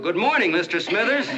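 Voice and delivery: booming, high-pitched holler of a greeting; huge reenacted yell. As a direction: yell it to be heard in the next county. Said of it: "So in a voice you could have heard in the next county, I yelled".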